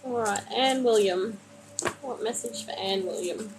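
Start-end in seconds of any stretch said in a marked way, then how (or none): 2.0-3.5 soft, private murmur; murmured asking. Real, not acted. Card reader asks for Anne William's message, murmured. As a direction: drop to a murmur for the question.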